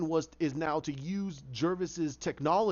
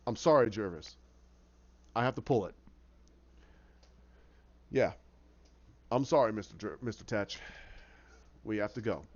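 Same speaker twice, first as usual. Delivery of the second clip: lower, measured official voice; firm doctor; 6 semitones lower than usual. Retold or imitated. Doctor telling Jervis the study is pulled, lower and firm. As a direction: deliver bad news with firm regret.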